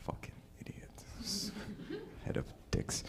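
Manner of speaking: barely audible slow whisper; whispered insult. Acted. Comic who promised respect, whispering an insult. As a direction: slip the insult out under your breath.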